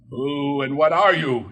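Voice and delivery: demanding. A narrator's reading. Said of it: "Scrooge demanded".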